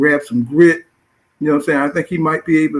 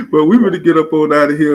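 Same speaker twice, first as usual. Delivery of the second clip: words pushed out loud through laughter; laughing while talking; 2 semitones higher, 7 dB louder than usual. Real, not acted. Host signing off through his own loud laughter. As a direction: still laughing as he signs off.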